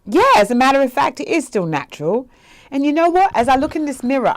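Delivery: loud, high-pitched comeback; defiant retort. Acted. Daughter answering her mother about her hair, emphatic retort. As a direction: answer back emphatically, voice lifted.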